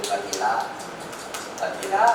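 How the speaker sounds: normal talking voice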